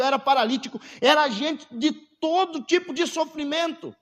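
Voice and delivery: impassioned pulpit proclamation; fervent preaching. Real, not acted. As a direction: preach it with rising force.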